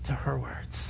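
breathy whisper. Retold or imitated. Young man whispering his plan to himself. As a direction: whisper it to yourself.

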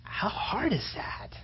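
slow, soft whisper. Real, not acted. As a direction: breathe the question out, slowly. Whispered rhetorical question.

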